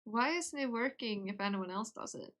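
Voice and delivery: ordinary talk, nothing marked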